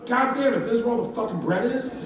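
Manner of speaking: high exasperated complaint; feigned indignation. Acted. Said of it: "I walk up and I go".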